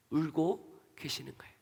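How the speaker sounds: a tender whispered reveal; hushed whisper. Acted. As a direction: breathe it out almost unvoiced, hushed and soft. Answer to why Jesus weeps.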